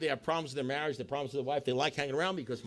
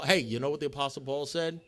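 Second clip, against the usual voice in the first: ordinary talk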